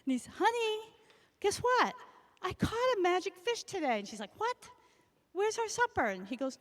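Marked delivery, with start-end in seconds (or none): 0.2-4.0 higher, eager news for his wife; excited husband. Acted. 4.4-6.1 higher, indignant question; surprised wife. Acted. Wife surprised, wants supper.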